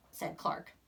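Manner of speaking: plain reading voice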